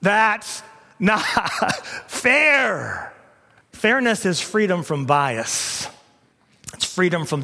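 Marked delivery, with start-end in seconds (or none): 0.0-3.1 mock whine. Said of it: "we cry out".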